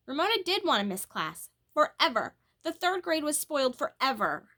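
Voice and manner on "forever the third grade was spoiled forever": ordinary delivery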